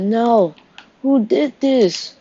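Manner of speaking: high, slow, anguished cry; a husband cries out over his dead wife. Retold or imitated. Grieving husband.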